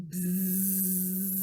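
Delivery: bee buzz. Pretend. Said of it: "That's what that one says".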